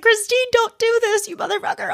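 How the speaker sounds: playfully begging her friend to stop; mock whiny protest. To her friend Christine using the therapist voice, a squeaky mock whine. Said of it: "And I was like".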